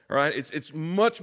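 ordinary talk, nothing marked

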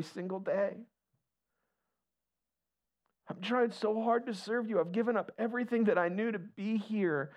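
high, slow, tearful pleading to God; a frightened father weeping on the roof, begging God; weeping prayer. Retold or imitated. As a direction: cry it out to God, afraid for his kids. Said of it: "he's just weeping".

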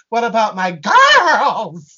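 put-on slow, loud What about my girls with girls punched; hitting girls hard. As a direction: lean hard on the word girls, drawn out. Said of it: "She really hits girls".

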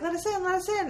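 high begging crewmates; lightly voiced pleading; mock pleading. Crew begging at the door.

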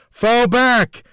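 high, loud scream of command. A narrator's reading. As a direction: yell the retreat order. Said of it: "she screamed with all the strength".

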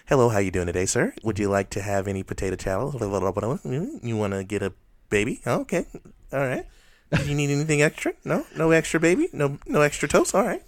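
high, loud, chirpy customer service. Shown as a demonstration.

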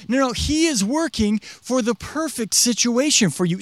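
ordinary talk, nothing marked

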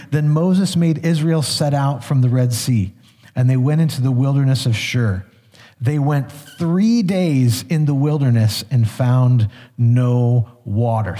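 scripture reading. Acted. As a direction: read the passage with weight.

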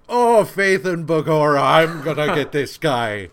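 loud, over-the-top stage Irish brogue; mock Irish brogue, loud and broad; hammed-up Irish accent. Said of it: "just as bad as Kevin, as far as the accent".

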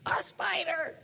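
high imitated little girl squeal; mock shriek. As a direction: squeal like a scared kid. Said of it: "granddaughters afraid".

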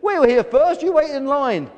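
indignant high, loud complaint; protesting. A narrator's reading. Gull pushed out of the queue, protesting. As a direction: object loudly at high pitch. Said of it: "one of them protested".

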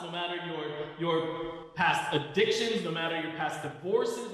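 emphatic sermon, building through a repeated list; preaching. Real, not acted. Pastor telling the church God forgives their past, preaching. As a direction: drive each point home to the congregation.

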